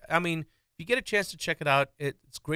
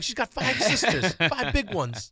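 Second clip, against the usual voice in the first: comic bargaining voice; 8 semitones higher, 5 dB louder, slower than usual. Retold or imitated.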